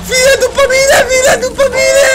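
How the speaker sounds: very high, loud scream; full-out real shriek of panic; terrified screaming. Real, not acted. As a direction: scream for help as it chases you. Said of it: "cât o să urlu acum".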